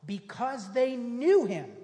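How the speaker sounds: slow, loud emphatic "Because they knew him"; stress on knew. Shown as a demonstration. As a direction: lean hard on "knew", slow.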